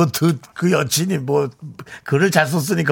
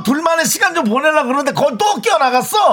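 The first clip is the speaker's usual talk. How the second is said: high-pitched incredulous telling-off; mock scolding; 7 semitones higher than usual. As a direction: berate the third wheel in comic outrage. Mock scolding the third wheel.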